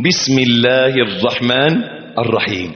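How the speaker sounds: usual speaking voice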